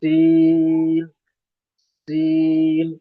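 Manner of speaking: سین drawn out very slowly with a long whistling s; slow, thin, whistling سین; whistled seen. Shown as a demonstration. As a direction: stretch the hiss in سین. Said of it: "سیٹی کی آواز آئے گی".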